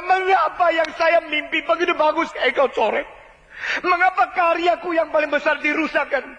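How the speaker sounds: high, plaintive protest to God; aggrieved believer questioning God; complaining believer. Acted. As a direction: whine at God about the ruined dream. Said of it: "waktu engkau mengatakan".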